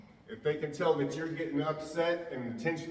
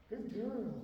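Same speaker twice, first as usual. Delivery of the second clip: high soft praise; 8 semitones higher, 10 dB quieter than usual. Real, not acted. Trainer quietly praising the anxious dog beside him, high and soft. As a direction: praise her gently so she stays calm.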